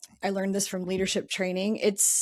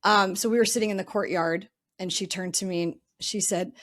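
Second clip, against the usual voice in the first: ordinary talk, nothing marked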